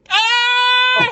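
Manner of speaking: long, high, loud held scream; hammed-up howl of pain; crippled man's scream. Italian guy crippled on the ground, hammed-up scream. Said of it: "he's on the ground and he's crippled".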